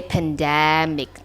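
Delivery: "pandemic" stressed on the middle "de"; stress on second syllable. Shown as a demonstration. Teacher showing where to stress "pandemic", second syllable stressed. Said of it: "The stress is on the second syllable".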